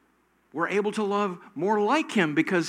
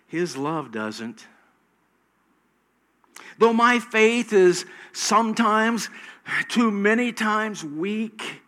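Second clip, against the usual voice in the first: rising, louder preaching climax; fervent preaching; 6 semitones higher, 11 dB louder than usual. Real, not acted. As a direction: build the sermon to full force, higher and louder.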